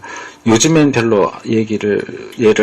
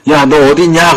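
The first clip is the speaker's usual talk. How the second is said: calling out; 5 semitones higher, 6 dB louder than usual. Retold or imitated. God calling Adam.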